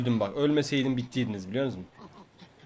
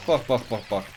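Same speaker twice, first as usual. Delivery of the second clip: ordinary talk, nothing marked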